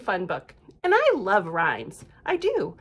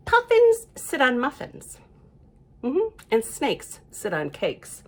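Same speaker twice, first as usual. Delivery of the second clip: ordinary delivery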